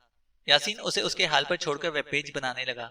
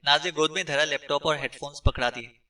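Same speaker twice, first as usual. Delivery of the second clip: ordinary delivery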